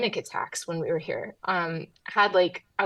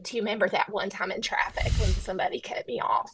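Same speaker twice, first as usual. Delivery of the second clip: put-on hushed Southern lady retelling an old grievance; whispery Southern woman reminiscing about traffic; Southern accent. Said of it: "do this entire interview with your Southern accent".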